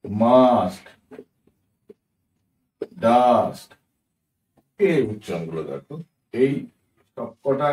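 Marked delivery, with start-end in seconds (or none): 0.0-0.8 "মাস্ট" with a long aa; drawn-out a. Shown as a demonstration. Teacher giving learners an example, drawn-out aa in "মাস্ট". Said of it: "আমরা টেনে উচ্চারণ করি". 3.0-3.7 "ডাস্ট" with a long aa; drawn-out a. Shown as a demonstration. Teacher giving learners an example, drawn-out aa in "ডাস্ট". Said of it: "আমরা টেনে উচ্চারণ করি".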